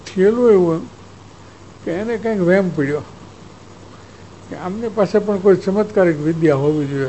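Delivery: ordinary talk, nothing marked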